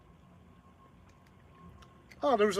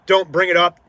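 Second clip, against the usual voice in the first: louder, firmer warning; changed firm tone; 2 semitones higher, 7 dB louder than usual. Retold or imitated. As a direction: shift into a no-nonsense tone. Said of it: "And then I changed my tone".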